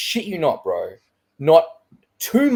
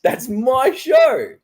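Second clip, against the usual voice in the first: mock indignation; 11 semitones higher, 5 dB louder, much slower than usual. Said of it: "I'm like".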